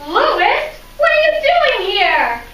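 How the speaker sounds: startled to wake up somewhere strange; confused waking surprise; surprised. Acted.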